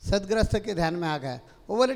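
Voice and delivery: normal talking voice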